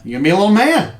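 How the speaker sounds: high, loud hearty dad voice; proud dad. Acted. Dad ruffling his son's hair, hearty and proud. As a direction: boom it out like a proud father.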